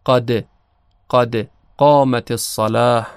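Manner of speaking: قد with the dal bouncing slightly towards kasra; qalqala on dal. Shown as a demonstration. Teacher showing students the bouncing dal in قد قامت الصلاة. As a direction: let the د of قد echo lightly. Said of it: "متمایل به کسره هست".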